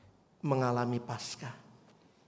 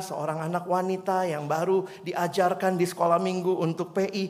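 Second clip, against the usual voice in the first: usual speaking voice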